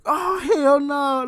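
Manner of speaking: high, slow drawn-out shout; mock shout. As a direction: stretch it out in loud mock disbelief. Classmates react, laughing at him.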